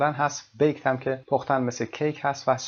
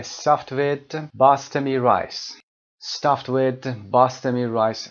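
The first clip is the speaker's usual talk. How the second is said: slow model reading; 4 dB louder, much slower than usual. Shown as a demonstration. Teacher models English item twice.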